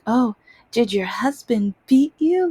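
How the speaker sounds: high, loud eager mock question; mocking nosy stranger. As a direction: ape their gleeful curiosity.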